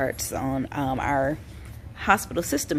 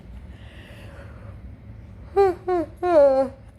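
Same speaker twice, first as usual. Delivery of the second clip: heavy sigh; 12 semitones higher than usual. Real, not acted. Tired vlogger sighs heavily.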